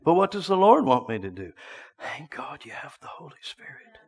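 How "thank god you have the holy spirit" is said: reverent whisper; hushed aside. Real, not acted. Preacher thanking God for the Holy Spirit, whispered. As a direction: drop suddenly to a whisper.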